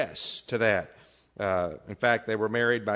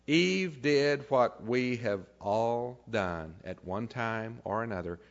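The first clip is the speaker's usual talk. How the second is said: normal talking voice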